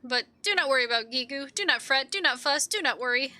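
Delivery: animated, earnest kobold voice; resolute kobold Gigu. Acted. Gigu vowing to win back Bibi, resolute. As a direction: vow it like a small determined hero.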